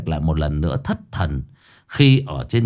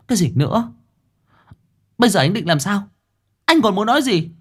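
high, sharp woman's voice demanding answers; angry woman; 11 semitones higher, faster than usual. Acted. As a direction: confront him as the fed-up woman. Woman confronts him angrily.

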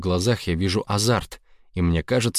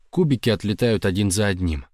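plain reading voice

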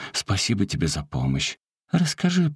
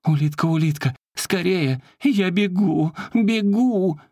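urgent calling; 11 semitones higher than usual. Acted. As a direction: call out in a hurry. Заколка зовёт, улитка отвечает. (высокий тревожный зов)

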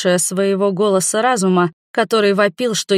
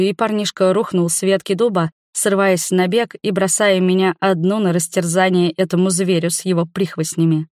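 usual speaking voice

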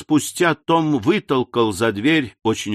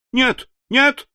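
much higher, quick eager no; eager denial; 12 semitones higher than usual. A narrator's reading. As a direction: jump in eagerly. Eager widow reassures Tom. (нетерпеливо, высоко)

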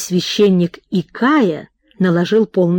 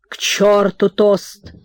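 rowdy dismissal; 5 semitones higher than usual. A narrator's reading. Host waving away the toast, rowdy and impatient. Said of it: "махал руками, тряс кудлатой бородой хозяин".

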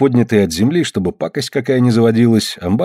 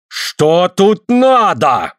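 high, very drawn-out angry demand; furious challenge; 7 semitones higher, much slower than usual. A narrator's reading. Suль turns on mocking Smolkov, furious challenge. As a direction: demand it high, stretching every word. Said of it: "бешено оборачивается Суль".

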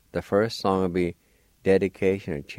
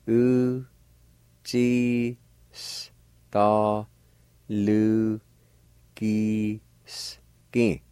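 Uchistalukiski drawn out very slowly in separate syllables; much slower than usual. Shown as a demonstration. Teacher breaking down Uchistalukiski for learners, very slow. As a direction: sound out Uchistalukiski one long syllable at a time. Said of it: "pronounce them out kinda slow".

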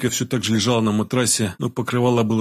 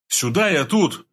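higher-pitched call to someone out of sight; 6 semitones higher, slower than usual. A narrator's reading. Man calls Boeing from the office, mildly yelled. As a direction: call out so he finds you. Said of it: "заорал я из кабинета".

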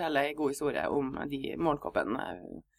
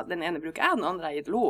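usual speaking voice

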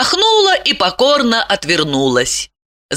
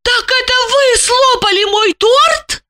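very high, amazed woman's voice; astonished. A narrator's reading. Lipochka learns he ate her cake, astonished. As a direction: shoot up in disbelief. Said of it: "изумилась Липочка".

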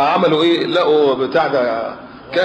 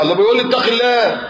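mock offended whine; 7 semitones higher than usual. Offended man mocked complaining.

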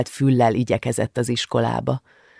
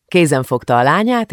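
usual speaking voice

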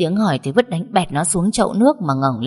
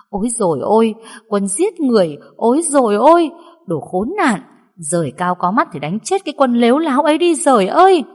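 loud, higher wailing curse through tears; wailing lament; 6 semitones higher, 5 dB louder than usual. A narrator's reading. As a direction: wail and curse the son at the top of her voice. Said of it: "vẫn đang mếu máo".

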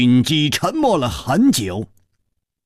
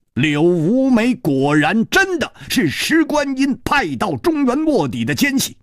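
ordinary delivery, nothing marked

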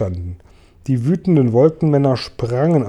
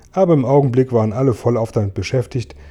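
ordinary delivery, nothing marked

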